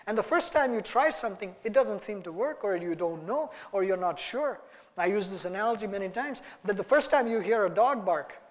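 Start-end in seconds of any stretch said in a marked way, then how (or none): none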